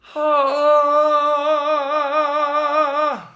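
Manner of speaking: loud, high sung scream; a screamed metal note. Shown as a demonstration. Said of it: "And then, like that".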